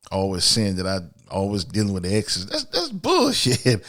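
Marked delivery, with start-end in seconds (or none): 2.5-3.9 scoffing. Real, not acted. Reacting to a listener's complaint.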